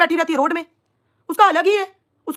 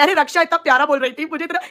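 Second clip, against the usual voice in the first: normal talking voice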